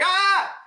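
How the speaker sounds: high startled "ah" yell; mock shocked scream. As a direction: imitate a cartoon shriek of shock. Characters' eyes pop in shock.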